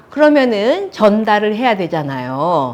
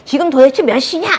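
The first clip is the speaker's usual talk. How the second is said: higher, louder scolding demand; angry mother; 7 semitones higher, 6 dB louder than usual. Retold or imitated. Mother at the front door to daughter, angry. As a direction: imitate mom telling you off. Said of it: "막 화를 내시는 거예요".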